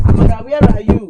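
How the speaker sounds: loud call across the room for Amara. Pretend. Teacher calls out to a pupil, loud. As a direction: call her name as if she's lost.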